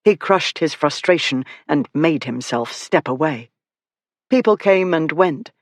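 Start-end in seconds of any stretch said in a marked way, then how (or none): none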